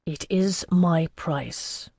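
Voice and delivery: slow, immovable statement; adamant. A narrator's reading. Alcuin holding to his price against Bouvard, adamant. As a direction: set down each word deliberately, refusing to budge. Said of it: "There was adamant in the words".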